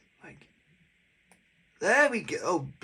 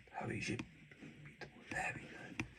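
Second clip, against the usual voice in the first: whisper; 15 dB quieter than usual. Real, not acted. Whispered while fixing necklace.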